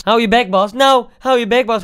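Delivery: loud, rising acted outburst; geïrriteerde uitval. Geïrriteerde uitval tegen klasgenoot Bas. (luid en geïrriteerd afkappen)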